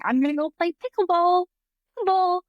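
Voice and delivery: high, pumped-up imitation; sing-song eager to go play; hyped-up mock eager. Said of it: "and you're acting like".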